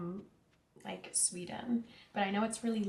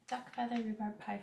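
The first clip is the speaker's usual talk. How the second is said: ordinary delivery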